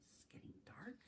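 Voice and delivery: hushed, secretive whisper. Acted. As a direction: lean in and whisper it's getting dark. Reader whispers to children.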